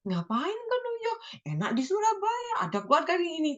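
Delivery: lightly hammed-up friend talking her out of it; imitating a nagging friend. Imagined worldly friend discouraging her, high mock voice. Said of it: "pasti diomongin yang enggak-enggak".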